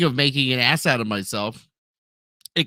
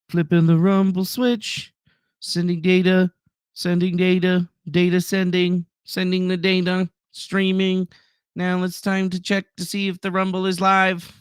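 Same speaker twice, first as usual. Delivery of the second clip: higher, level monotone; mock computer voice; 3 semitones higher than usual. Streamer narrating the stream fix, monotone robot announcement. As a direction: announce each step like a machine.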